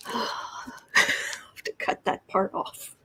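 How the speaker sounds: sighing whisper to herself; whispered aside. Real, not acted. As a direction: mutter it under a sigh. Aside after cat incident.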